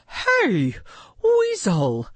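high-pitched heron hailing someone; Maggie calling out. A narrator's reading. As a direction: call out sharply in a high bird voice. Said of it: "Maggie called out".

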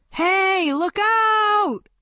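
high, loud warning call; warning shout. Acted. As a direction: shout to warn of danger.

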